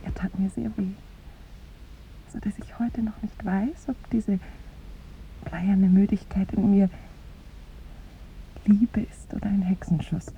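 soft, wry whispered musing. Acted. Woman whispering her confusion to the visitor. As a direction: murmur it confidentially.

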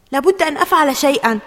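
quick, high, loud resolve; determined self-talk. A narrator's reading. Ali telling himself to act, determined. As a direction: urge yourself on, fast and loud. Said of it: "قال علي في نفسه".

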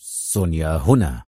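bitter. Acted. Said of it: "في مرارة".

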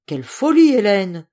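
emphatic, exclaimed rebuke; loud protest. Acted. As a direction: hasten to scoff at the idea. Hastily rebukes wife before furious general.

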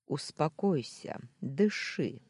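ordinary delivery

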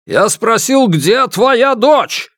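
high, forceful repeated demand; angry shout. A narrator's reading. Intruder repeats his demand to the householder, angry shout. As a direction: shout the question, pitched well up. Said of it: "закричал Ма Дуньчэнь".

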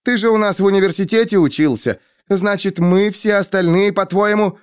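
high-pitched goading accusation; sarcastic. A narrator's reading. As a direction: needle him in a heated quarrel. Quarrel, Denna mocks him. (язвительно, с вызовом)

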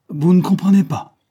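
high, loud furious outburst; enraged cry, pitched very high; mad with rage. A narrator's reading. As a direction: lash out in exasperated rage. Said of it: "folle de rage".

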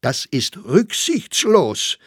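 high, slow outraged protest; indignant exclamation. A narrator's reading. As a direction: cry it out, stretching the words. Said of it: "rief Sachsberger aus".

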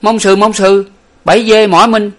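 very high, loud jeering cry; shouting mock French. A narrator's reading. Lục Tặc shouts broken French from outside, high and loud. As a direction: cheer it out like a street kid. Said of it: "la lên rằng".

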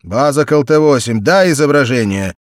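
loud base radio order; radio command. A narrator's reading. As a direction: give the order into the mic. Base answers pilot by radio.

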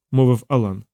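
ordinary delivery

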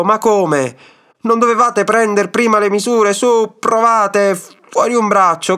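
high-pitched exasperated shouting. A narrator's reading. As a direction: yell at the old man, scolding and directing. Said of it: "gridò al vecchio". (grida esasperate e acute)

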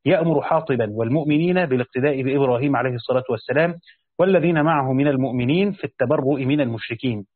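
usual speaking voice

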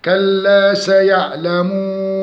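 slow chanted كلا سيعلمون, long final oo; measured tajweed chant, held oo in سيعلمون; madd recitation. Shown as a demonstration. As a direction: stretch the ending of سيعلمون. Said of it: "نفس المد العارض للسكون".

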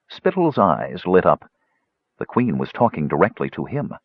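ordinary delivery, nothing marked

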